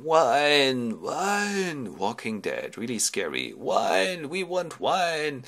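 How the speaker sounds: slow, eerie ghost moan; moaning dead. Acted. The dead of Hades beg Ulysses for wine, slow moan. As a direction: drag the word out like the dead.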